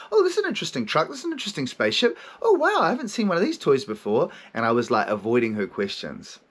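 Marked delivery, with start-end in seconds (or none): 0.1-4.3 higher, bright mock child's voice distracting; imitating a little boy fascinated by toys; five-year-old self. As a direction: play the kid dodging questions with fake wonder.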